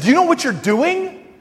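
retold indignant question, pitched high; getting fired up. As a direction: challenge her, voice climbing. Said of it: "I'm getting fired up".

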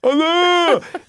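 high, loud comic wail; mock dismay. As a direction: cry out in exaggerated dismay. Acted dismay at missed stop.

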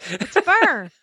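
"fur" echoed as a one-syllable Southern fire; imitated Southern "fire". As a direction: copy the caller's drawn-together "fur" for fire. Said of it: "It's not fire, it's fur".

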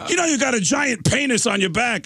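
high, incredulous retold exclamation; insistent exclaiming. Singer telling the drummer about his tattoo, insistent exclaiming.

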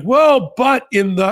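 imitated protest. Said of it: "And he was like".

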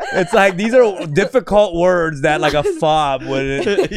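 high, slow, laughing through words; can barely get it out for laughing. Real, not acted. Laughing hard after a bit.

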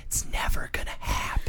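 soft whispered in the ear. Retold or imitated. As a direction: whisper it close. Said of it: "went up to my ear and whispered".

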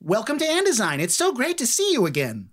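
high, gushing halfling welcome; excited greeting. Acted. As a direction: greet old friends in a high, bubbly voice.

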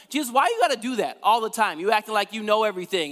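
quick, high-pitched sibling complaint; mock-exasperated younger brother; exasperated griping. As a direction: gripe at your big brother in a rush.

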